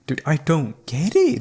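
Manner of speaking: ordinary talk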